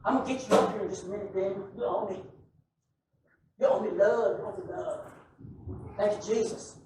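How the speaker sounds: fast, soft aside off the mic; quiet aside. Real, not acted. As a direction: murmur it to Damon, half to yourself. Quick aside to Damon.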